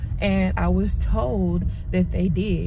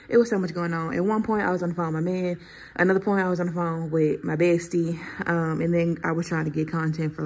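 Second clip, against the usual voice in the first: usual speaking voice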